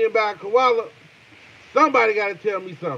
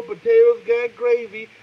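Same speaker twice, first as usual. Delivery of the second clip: usual speaking voice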